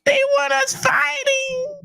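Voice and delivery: high, slow, whimpering mock voice; mock him in a high teary whine; punk bitch fleeing voice. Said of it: "read it in a little punk bitch fleeing voice".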